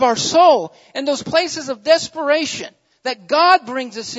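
loud, high, driving preacher's cry; a revival preacher pouring it on the congregation; full-force preaching. Acted. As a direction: preach it out with fire.

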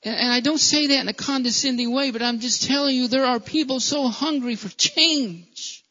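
loud, high-pitched pulpit fervour; fervent sermon climax; impassioned preaching. Acted. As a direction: lift the congregation with urgent preaching. Preacher builds to loud plea.

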